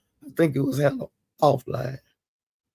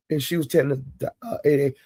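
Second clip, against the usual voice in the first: ordinary talk, nothing marked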